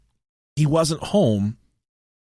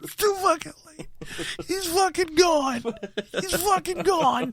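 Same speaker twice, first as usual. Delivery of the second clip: mock despair. Retelling his panic over his vanished roommate, mock despairing. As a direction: act like your friend is already dead.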